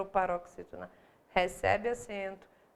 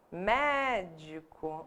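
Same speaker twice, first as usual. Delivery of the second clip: "Médico" said slowly, MÉ-di-co, stress on MÉ; slow syllables; much slower than usual. Shown as a demonstration. Teacher to class, slowly marking the stressed syllable. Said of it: "Ela é proparoxítona".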